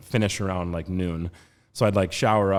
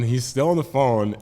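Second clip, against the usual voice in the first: ordinary talk, nothing marked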